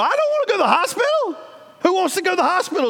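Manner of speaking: fast, high whiny protest. Retold or imitated. To the doctor, fast high protest. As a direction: rush it out, refusing.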